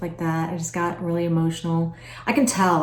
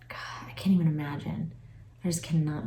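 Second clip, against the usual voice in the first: soft unvoiced whisper, holding back tears; real choked whisper; tearful whisper; 7 dB quieter than usual. Real, not acted. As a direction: barely breathe it out, moved. Tearing up over children leaving.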